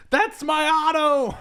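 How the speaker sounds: high, slow mock cry of recognition; hammed-up high-pitched outburst; Sam Raimi. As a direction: belt it out high, hamming it up. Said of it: "Sam Raimi saw it, and he's like".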